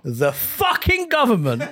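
"fucking" hit slow and high; overemphasised newsreading. Pretend. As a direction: punch "fucking" like an outraged newsreader. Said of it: "You overemphasize words when you're reading".

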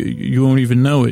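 ordinary talk

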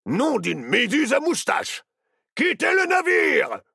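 high, loud pirate bellow; panicked command. A narrator's reading. Captain orders crew to abandon ship, alarmed shout. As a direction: bellow an oath, then the order.

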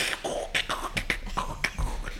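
mouth-made DJ scratches; turntable scratching noises. Shown as a demonstration. DJ showing what he did behind his mates, scratching noises. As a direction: imitate record scratching with rhythmic voiceless clicks. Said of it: "stood behind my mates and went".

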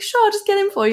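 very high, chirpy put-on parent; obliging parent. Friend's parent answering the home phone, high put-on politeness.

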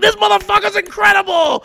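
very high, loud mock amazement; imitated raving. Retirees rave about the comic, mock thrilled cry. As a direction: gush at top of range, hammed-up. Old audience raves.

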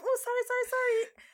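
acted flustered, very high and loud; flustered apology. Woman who bumped into a stranger at sea, flustered. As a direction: blurt out a mock panicked apology. Acts out apologising after bumping someone.

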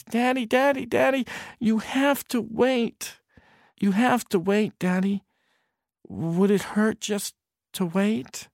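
put-on high insistent child's plea; high childish coaxing; pleading little boy. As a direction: coax your impatient dad. Boy pleads father to wait.

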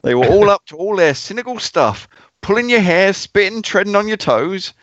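high-pitched, hammed-up Cockney; best Cockney accent. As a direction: read it out as a mock Cockney. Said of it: "the stat's best Sydney Cockney accent".

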